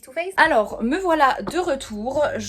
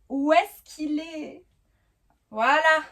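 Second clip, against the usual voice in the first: drawn-out, higher musing while looking; slow, higher wondering aloud; slow searching; 5 semitones higher, 3 dB louder, much slower than usual. Real, not acted. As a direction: stretch it out slowly as you hunt. Searching for her top.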